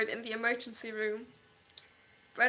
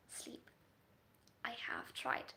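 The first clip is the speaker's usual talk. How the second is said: whisper; 12 dB quieter, much slower than usual. Real, not acted. Exhausted sleepless confession.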